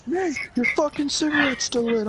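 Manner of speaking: high-pitched imitated warning yell; alarmed callout. Friends warn Nate about lit cigarette, high alarmed shout. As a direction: mimic friends yelling in alarm.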